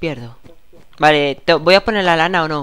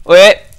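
startled yell; 4 semitones higher, 14 dB louder than usual. Real, not acted. Jumping into fire in game.

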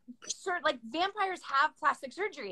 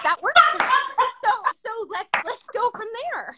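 laughing reply; 8 semitones higher, 7 dB louder than usual. Retold or imitated. Retelling her own gleeful reply.

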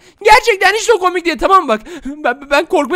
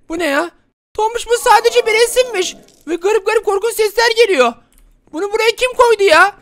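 ordinary talk, nothing marked

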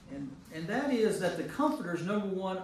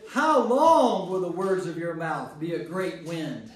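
loud, indignant reading of the friend's rebuke; riled-up quote; 9 dB louder than usual. Retold or imitated. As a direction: read it as if riled by Job. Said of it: "You said".

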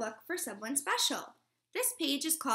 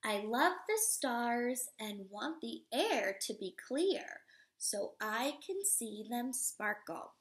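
slow, expressive storybook read-aloud; reading to children; slower than usual. Acted. Teacher reading a picture book to children, expressive read-aloud. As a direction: read it warmly to a class of little kids.

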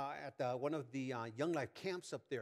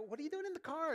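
retold incredulous question; 13 semitones higher, faster than usual. Retells confronting wife in car.

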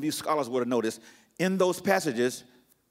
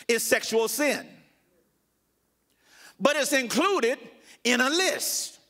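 slow, high, deliberate pulpit delivery; emphatic preaching; 7 semitones higher, much slower than usual. Real, not acted. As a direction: drive each phrase home with long pauses. Preaches the main point.

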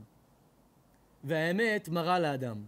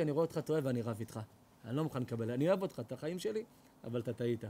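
gentle rebuke; 6 semitones lower, 4 dB quieter, faster than usual. Retold or imitated. True friend's gentle rebuke.

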